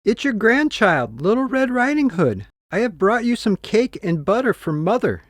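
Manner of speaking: put-on a cheerful little girl at the door. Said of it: "she said".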